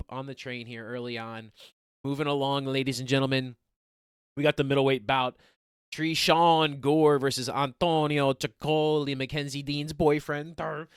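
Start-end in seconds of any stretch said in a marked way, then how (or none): 2.0-9.2 announcer voice. Acted. Host announcing the middleweight bout to viewers, ring-announcer style. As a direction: present the next bout like an arena announcer.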